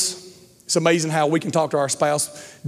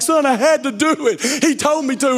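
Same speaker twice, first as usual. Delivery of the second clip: arguing dad; 10 semitones higher than usual. Retold or imitated.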